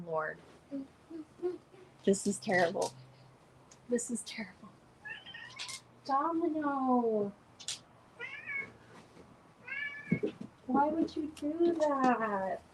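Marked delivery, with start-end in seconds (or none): 3.9-4.7 whisper. Real, not acted. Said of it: "repeated softly". 6.1-12.6 talking to the cat. Real, not acted. Scolding the cat gently.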